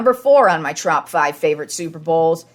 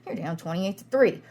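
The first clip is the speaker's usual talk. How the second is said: much softer, doubtful under the breath; muttered disbelief; 9 dB quieter than usual. Retold or imitated. Fan doubting the comeback, quiet and skeptical. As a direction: grumble it to yourself, unconvinced. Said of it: "And you're just like".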